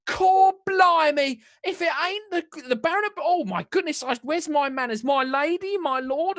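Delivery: high, loud Cockney gush; a flustered Cockney shopkeeper greeting nobles; Cockney baker woman. Acted. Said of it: "I'm gonna lose all my accents".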